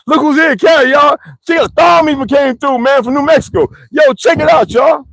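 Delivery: high, loud welcoming hype shout; imagined livestream shout. Pretend. Streamer greeting friends on a pretend live show, loud excited shout. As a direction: call out to the chat like a hyped host greeting a guest. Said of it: "we go live and be like".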